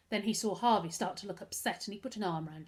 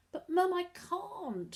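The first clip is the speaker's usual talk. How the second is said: high, slow pleading whimper; pathetic plea; 11 semitones higher, much slower than usual. A narrator's reading. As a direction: beg like a helpless little boy. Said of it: "Harvey said pathetically".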